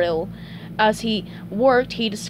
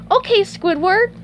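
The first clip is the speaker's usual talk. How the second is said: high, loud chirp, rising; SpongeBob pleasant; 11 semitones higher, 5 dB louder than usual. Acted. Co-worker starting to walk away, high pleasant chirp. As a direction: answer pleasantly in a high cartoon voice. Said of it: "SpongeBob said pleasantly".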